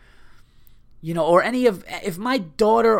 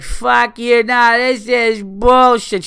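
put-on high, loud, drawn-out drunk girl's yell; mock drunk Boston woman, high and loud; 8 semitones higher, 9 dB louder, slower than usual. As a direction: shriek it like a drunk girl fed up with the night. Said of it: "And she's like".

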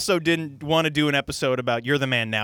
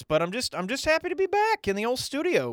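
high-pitched, excited welcome back; enthusiastic; 10 semitones higher than usual. Real, not acted. Podcast host glad to be back with his friend, enthusiastic. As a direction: bubble over at being back in the studio. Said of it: "get this amount of enthusiasm".

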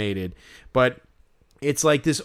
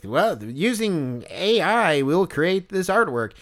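slow, higher-pitched stilted announcer; canned hot dog host; 4 semitones higher, slower than usual. Acted. Hot dog character explains its artwork, canned and stilted. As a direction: drag it out like an automated video host. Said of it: "and it's like".

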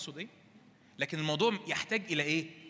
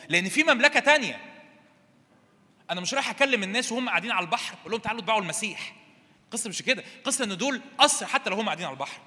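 loud, raised preaching voice; forceful preaching; 4 semitones higher, 6 dB louder, faster than usual. Real, not acted. Preacher teaches congregation about binding the strong man, forceful. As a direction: pound out the key words at full force.

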